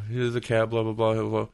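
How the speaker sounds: ordinary talk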